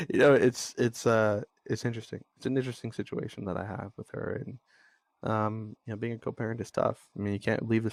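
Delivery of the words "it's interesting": ordinary talk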